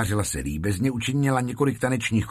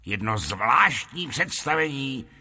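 ringmaster's announcement, rising higher by the end; proclaiming; 4 semitones higher than usual. A narrator's reading. Lotrando announces a special show for the lord, proclaiming. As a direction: announce the honoured guest with ceremony. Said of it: "se znova poklonil až k zemi a provolal".

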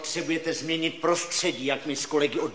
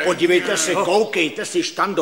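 loud, hearty urging; insistent, loud persuasion; emphatic encouragement; 7 dB louder than usual. Acted. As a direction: boom out reassurance and push them to go. Doctor eagerly urges him to go.

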